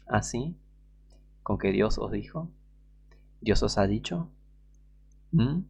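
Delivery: a hint of a soft, coaxing serpent; seductive serpent. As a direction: lightly voice the snake's smooth temptation. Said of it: "el tono seductor de la serpiente".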